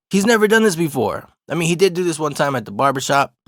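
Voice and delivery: ordinary delivery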